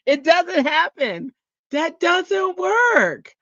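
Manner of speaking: very high and very slow; mock high voice. Laughing at her own forgetfulness in a high slow voice. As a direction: stretch it out up high in mock despair.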